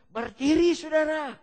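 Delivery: high, loud altar-call command; preacher calling out. Retold or imitated. Preacher on tape tells listeners to stand, calling out. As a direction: call the congregation to its feet.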